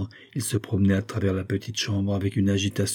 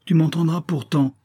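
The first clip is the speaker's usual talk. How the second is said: high-pitched, stubborn insistence; obstinate; 8 semitones higher, 3 dB louder than usual. A narrator's reading. Friend insisting on being heard, obstinate. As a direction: insist that he will listen. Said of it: "avec obstination".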